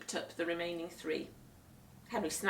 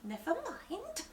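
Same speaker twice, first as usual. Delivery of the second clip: higher, softer tight politeness; through gritted teeth; 12 semitones higher, 5 dB quieter, slower than usual. Acted. Guest hiding fury, through gritted teeth. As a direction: force the words through your teeth. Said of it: "through gritted teeth".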